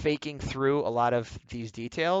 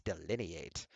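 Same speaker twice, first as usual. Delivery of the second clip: "delineate" repeated much softer and lower; 7 semitones lower, 12 dB quieter, slower than usual. Shown as a demonstration. Artist savouring the word, quiet low repeat of delineate. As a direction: murmur "delineate" to yourself.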